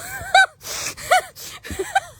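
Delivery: very high, wheezy laughing fit. Real, not acted. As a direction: crack up helplessly, squealing high. Laughing at the horse's antics.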